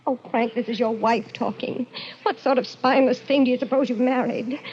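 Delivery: usual speaking voice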